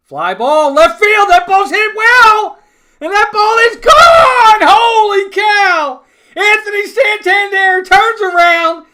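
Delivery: real shouted play-by-play, slowed on the big moments; screaming home run call. Real, not acted. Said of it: "Sorry if I'm screaming".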